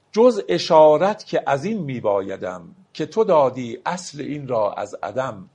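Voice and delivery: slow, measured reading of a Persian verse; poetry recitation. Acted. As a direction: lay out each word of the couplet slowly. (دکلمهٔ آرام شعر)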